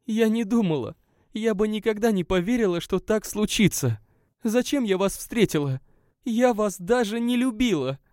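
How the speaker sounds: distressed woman. A narrator's reading. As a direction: she defends what she treasured. Narrator voices the guest. (женский голос, обиженно и искренне)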